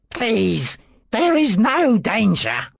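smug, unhurried reassurance; complacent. A narrator's reading. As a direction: wave away their fear. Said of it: "Pompedius said complacently".

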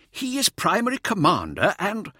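high, insistent objection; affronted, about to be cut off; indignant protest. A narrator's reading. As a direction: press the point in a high voice. Senior protests, gets cut off.

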